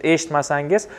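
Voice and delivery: ordinary talk, nothing marked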